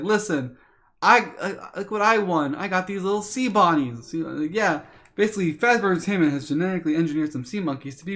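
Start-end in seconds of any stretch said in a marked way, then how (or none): 0.0-4.1 put-on higher, excited kid showing off his prize; little brother voice. Little brother showing off his new pets to his brother. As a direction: pipe up like an eager little kid.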